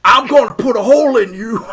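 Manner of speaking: gruff gunslinger threat, laughing through it; tough cowboy voice. Retold or imitated. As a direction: play the old western hero, half laughing. Clint Eastwood impression in chat.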